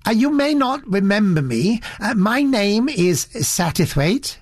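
a hint of a timid elderly gentleman introducing himself; polite little gentleman. Satterthwaite greets the producer.

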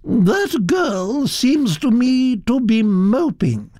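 put-on high, gossipy old aristocrat voice, pitched well up; elderly Duchess. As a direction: chatter on like a grand old lady. Duchess voiced again, higher.

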